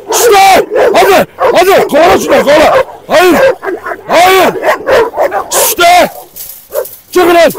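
very high, loud commanding yells; yelling at fighting dogs. Real, not acted. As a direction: shout them apart, urgently. Breaking up a dog fight. (kavga eden köpeklere bağırma)

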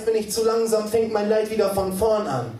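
high, driving spoken word; insistent rhythmic recitation; urgent slam poetry. Acted. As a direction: hurtle through the lines breathlessly on the beat.

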